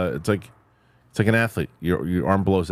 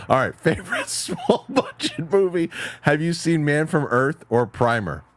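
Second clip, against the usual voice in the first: laughing; 8 semitones higher than usual. Real, not acted.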